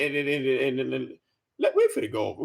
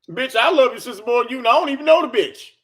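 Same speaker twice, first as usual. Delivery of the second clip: fast, louder, higher comeback; heated outburst; 5 semitones higher, 6 dB louder, faster than usual. Real, not acted. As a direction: fire the insult back quickly and loudly.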